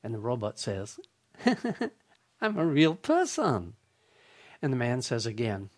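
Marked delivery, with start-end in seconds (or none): 1.4-1.9 higher short machine chuckle; the same programmed giggle; light fake robot laugh; canned robot laugh. Robot caller laughs again. 2.4-3.7 a touch higher, canned cheerful reply; same recorded bot answer; lightly voiced robot; robot caller. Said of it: "repeated on the tape over and over".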